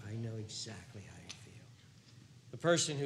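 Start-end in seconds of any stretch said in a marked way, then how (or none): 0.1-1.6 soft unvoiced hush; whispered comfort. Jesus whispering comfort to a weary believer. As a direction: breathe it gently like a secret.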